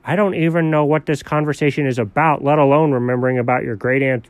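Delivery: ordinary talk, nothing marked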